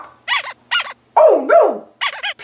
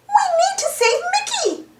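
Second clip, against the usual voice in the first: much higher Minnie Mouse voice, a touch softer; 12 semitones higher, 3 dB quieter than usual. Acted. Minnie urges friends to save Mickey, high cartoon voice. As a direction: sound like Minnie, eager to rescue. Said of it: "says Minnie".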